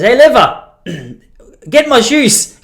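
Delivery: loud, high mock grandpa summons; commanding grandfather. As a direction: call out a slow, drawn-out order to the wife. Grandfather calls to wife.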